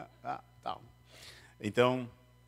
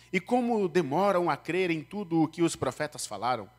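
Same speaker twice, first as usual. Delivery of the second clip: higher, solemn Bible reading; scripture reading; 6 semitones higher, 3 dB louder than usual. Acted. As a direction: read the passage to the congregation. (leitura bíblica solene)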